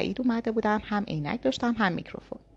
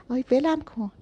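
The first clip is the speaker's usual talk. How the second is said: higher, louder yelp of pain; pained protest; 6 semitones higher, 4 dB louder than usual. A narrator's reading. Girl protests while her hair is yanked, pained yelp. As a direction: cry out as your hair is pulled hard.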